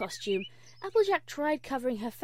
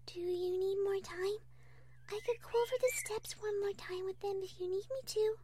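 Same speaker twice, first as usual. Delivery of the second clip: timid, considerate, high soft voice; Fluttershy; 8 semitones higher, 6 dB quieter than usual. Acted. Fluttershy offering Applejack more time, gentle and timid.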